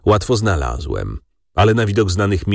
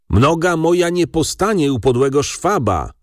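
high-pitched, restrained shout; angry cry; 9 semitones higher than usual. A narrator's reading. Enraged Jan after his brother leaves, high angry cry. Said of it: "krzyknął Jan".

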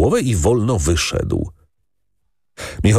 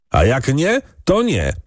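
higher, recklessly tossed-out shout; defiant shout; 7 semitones higher than usual. Acted. A servant blurting out defiance at the ordinate, higher. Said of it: "krzyknął drugi rozrzutnie".

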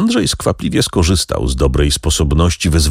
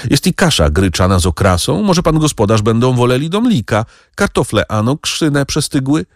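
sweetly coaxing maid, higher; 7 semitones higher than usual. A narrator's reading. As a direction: fawn over the master a little. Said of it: "mówiła Franka przymilnie".

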